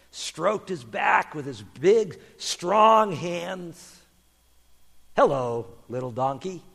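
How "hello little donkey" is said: low, gentle hello; kindly greeting. Acted. Jesus greets the young donkey, kindly and calm. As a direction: greet a small animal tenderly.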